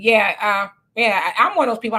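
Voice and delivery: ordinary delivery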